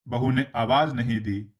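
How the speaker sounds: ordinary delivery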